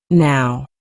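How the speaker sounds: ordinary delivery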